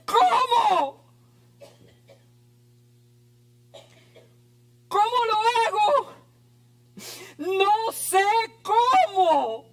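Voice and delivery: pleading churchgoer. Acted. Voicing a troubled churchgoer.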